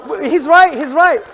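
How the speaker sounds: loud and high, "he's right" called out over the crowd; sarcastic shout repeating "he's right" to answer a heckler; mock agreement shout. Real, not acted.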